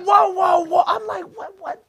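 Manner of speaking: loud, high imitated alarm; high-pitched startled objection; panicked protest. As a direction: blurt it out in mock panic. Retold panic at arrest.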